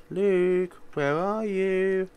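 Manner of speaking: high, slow sing-song call; sing-song calling mother. Acted. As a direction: call through the house sweetly.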